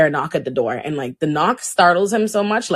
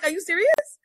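retold disbelief; 13 semitones higher than usual. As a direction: pitch it way up in stunned disbelief. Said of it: "I'm like".

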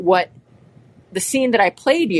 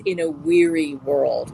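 slow, hushed line from the film; movie line recited. Retold or imitated. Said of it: "in the movie, he says".

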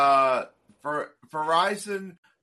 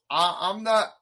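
ordinary talk

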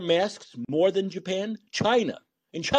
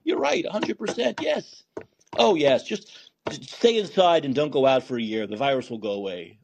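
mock placating doctor. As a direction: gush agreement to calm them. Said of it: "Like doctors in a crazy house do".

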